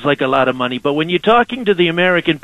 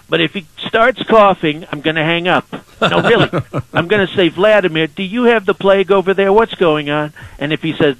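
Donald Trump impression. Trump calling in to radio hosts, swaggering and boastful. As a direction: sell every line like the president on a call-in.

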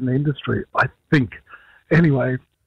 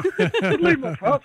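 joking plea through a chuckle, pitched up; laughing plea; 11 semitones higher than usual. Retold or imitated. Teasing [name] backstage about his awards, laughing plea. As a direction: laugh while mock-begging a brother.

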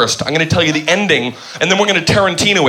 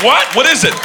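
imitating audience; 8 semitones higher than usual. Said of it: "you're like".

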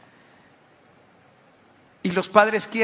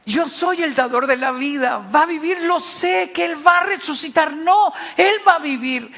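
raised, mounting declaration; emphatic assurance; 7 semitones higher, 4 dB louder than usual. Acted. Jesus answering grieving Martha about her brother, emphatic assurance. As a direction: build higher and louder with firm conviction.

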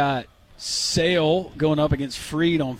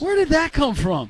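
incredulous; 11 semitones higher, 3 dB louder than usual. Real, not acted. Co-host asks about taking Molly.

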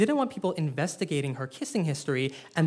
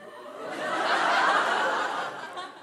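high breathless laugh; 13 semitones higher than usual. Real, not acted. Laughing at own story.

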